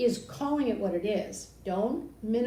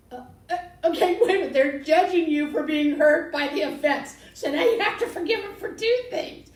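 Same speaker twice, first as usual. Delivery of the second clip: incredulous outburst; 7 semitones higher, 8 dB louder than usual. Real, not acted. Outraged at blaming the hurt.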